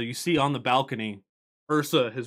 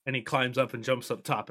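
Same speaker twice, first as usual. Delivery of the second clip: usual speaking voice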